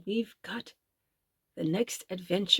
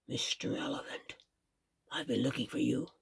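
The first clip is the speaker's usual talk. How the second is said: whisper; 8 semitones lower, 9 dB quieter than usual. A narrator's reading.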